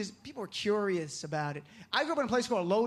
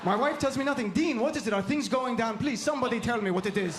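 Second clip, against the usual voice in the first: imitating dad's heavy Middle Eastern accent; baffled immigrant father with a Middle Eastern accent. Baffled dad answers Jersey kids, Middle Eastern accent. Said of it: "my dad has an accent".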